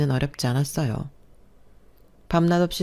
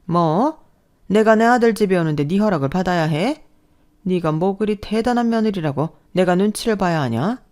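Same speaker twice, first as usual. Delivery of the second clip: a louder, affronted scolding; indignant mother-in-law; 2 semitones higher, 4 dB louder than usual. Acted. Mother-in-law outraged at being asked to call first. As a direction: demand how dare she, in outrage.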